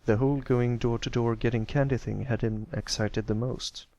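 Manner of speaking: usual speaking voice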